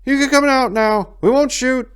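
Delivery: high, loud other-monk voice; calling from cover. A narrator's reading. Hidden monk calling to Tor.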